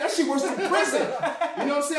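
loud, high laughing mock outrage; laughing rant. Telling the host about the psych ward, loud laughing rant. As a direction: rant while cracking up.

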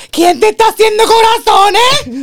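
shrieking, slow jealous accusation; very high jealous outburst with a laugh; jealous wife. Retold or imitated. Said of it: "le escribe a Adi".

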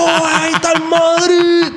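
high, drawn-out mock fan yell; rowdy street fan. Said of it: "Un chacal, pero chacal".

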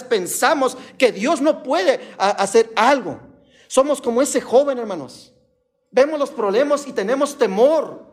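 high, insistent sermon delivery; fervent preaching. Real, not acted. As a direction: preach it with rising urgency, phrase after phrase. Preaches to congregation.

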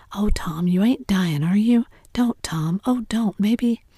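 loud, alarmed pleading; frightened. Acted. Sid frightened Tom's dying.

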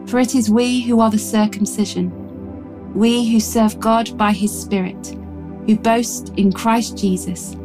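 usual speaking voice

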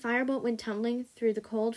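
ordinary delivery, nothing marked

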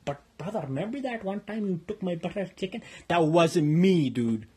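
ordinary talk, nothing marked

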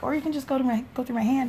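high-pitched retold plea; tentative suggestion. Retells timid suggestion to doctor.

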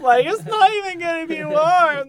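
hammed-up American griping; mocking an American over cold tea, loud and whiny; mock American whine. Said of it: "single American wakes up and goes".

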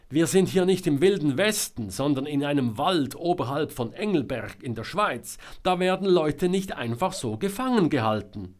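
loud, high, disbelieving lecture; scolding retort. A narrator's reading. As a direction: tell someone off at a distance. Selma scolds Georg across the woods. (laut und empört zurechtweisen)

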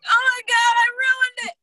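very high, loud mock despair; hammed-up panicky squeal; panicked wail. Designer after a year of nothing happening, panicked wail.

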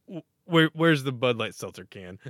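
real goal-night frenzy; frantic excited yell. Retold or imitated. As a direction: shout it in a happy panic. Freaking out at overtime goal.

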